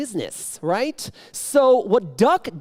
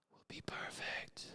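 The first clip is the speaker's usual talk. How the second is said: much softer, whispered and slow; dropping to an intense whisper; 25 dB quieter, slower than usual. Acted. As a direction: hush it like a sacred vow. Vowing perfection in a whisper.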